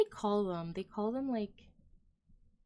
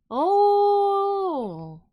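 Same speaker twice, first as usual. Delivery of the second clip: a sudden, much higher shout of surprise; surprised cry; 11 semitones higher, 11 dB louder than usual. Real, not acted. Excited cry when chat suggests learning Russian. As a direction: burst out in delight at the idea.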